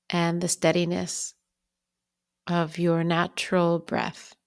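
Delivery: unhurried meditation guide, long pauses between phrases; calm, even-pitched bedtime guidance with lingering pauses; guided bedtime meditation. Real, not acted. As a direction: ease the listener toward sleep, phrase by phrase.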